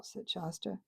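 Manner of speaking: usual speaking voice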